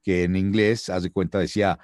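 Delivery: usual speaking voice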